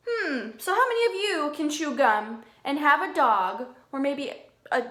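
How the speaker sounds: ordinary talk, nothing marked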